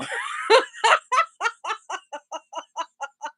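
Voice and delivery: high, uncontrollable real laughing fit; hard laughter. Real, not acted. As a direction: crack up helplessly at your own story. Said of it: "I'm laughing so hard".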